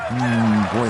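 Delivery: ordinary talk, nothing marked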